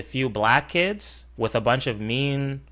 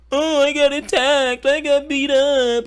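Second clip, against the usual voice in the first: high, louder whiny mock voice; mocking whiner; 13 semitones higher, 4 dB louder than usual. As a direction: mimic a crybaby, pitched up.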